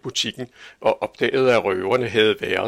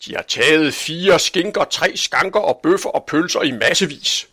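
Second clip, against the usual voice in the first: higher, louder outraged list; indignant listing; 6 semitones higher, 5 dB louder than usual. A narrator's reading. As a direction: reel off the losses indignantly. Angry butcher lists stolen goods. (forarget opremsning)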